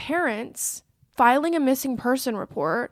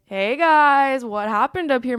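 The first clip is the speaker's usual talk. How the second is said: breezy greeting; 3 semitones higher, 7 dB louder than usual. Retold or imitated. Schmidt calling up cheerily to the boys, a loud breezy hello. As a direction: call up the stairs like nothing's wrong.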